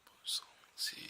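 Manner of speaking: awed whisper. Real, not acted. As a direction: hush it out in wonder. Admiring the card.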